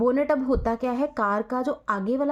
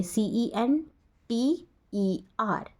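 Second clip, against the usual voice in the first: सीईएनटीईआर spelled very slowly letter by letter; slow spelling out; much slower than usual. Shown as a demonstration. Teacher spells सीईएनटीईआर very slowly for students. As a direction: drag out each letter. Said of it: "टीईआर वाले हमारे American होंगे".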